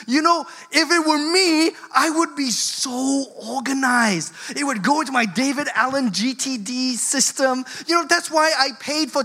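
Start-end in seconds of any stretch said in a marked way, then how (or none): none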